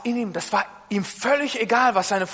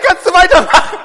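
high and much louder, laughing through it; pleading laugh; 9 semitones higher, 12 dB louder than usual. Retold or imitated. Husband begging his wife to take over, laughing. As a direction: beg for help while cracking up. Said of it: "Ich sag".

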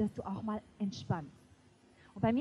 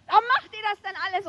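imitating a frazzled mother's fast, higher yell; mock yell like a mother losing her nerve; pastor's wife shouting at her six kids, imitated; mother's mock scream; 9 semitones higher, 8 dB louder, faster than usual. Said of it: "dann hat sie geschrien".

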